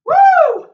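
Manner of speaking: high loud cheer; loud whoop. Real, not acted. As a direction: let out a big whoop. Whoop after finishing set.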